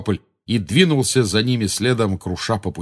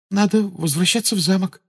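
put-on higher-pitched helpless girl's reply; 11 semitones higher than usual. A narrator's reading. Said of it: "беспомощно ответила Гермиона". (немного беспомощно, высоким голосом)

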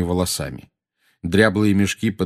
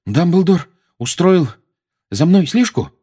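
slow, halting, louder question; strained, higher, broken phrasing; out of breath; 10 semitones higher, 6 dB louder than usual. A narrator's reading. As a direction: pant out the question with effort. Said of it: "задыхаясь, спросил Гарри".

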